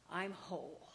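telling her father why she drinks, softly and sincerely; quiet confession. Retold or imitated. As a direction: let it fall to almost a whisper.

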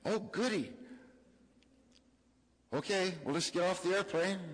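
put-on high, cheery childlike voice; a delighted small child; high-pitched kid voice, pleased; happy little girl. Little daughter happy to arrive.

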